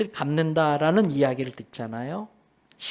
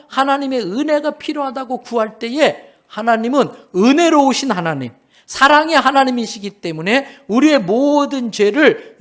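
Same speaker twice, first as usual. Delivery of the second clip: loud, higher-pitched sermon climax; fervent, raised pulpit delivery; impassioned preaching; 8 semitones higher, 5 dB louder than usual. Real, not acted. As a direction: proclaim it forcefully to the congregation. Preaching climax at full force.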